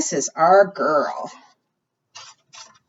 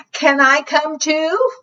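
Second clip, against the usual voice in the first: much higher, pleading bear; hopeful bear; 9 semitones higher, slower than usual. Acted. Bear sliding down a tree asking to come, high. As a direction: ask sweetly and eagerly.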